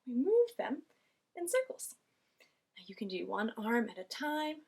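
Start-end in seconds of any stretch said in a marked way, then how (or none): 0.1-1.9 kindergarten teacher voice. Acted. Showing arm circles to children.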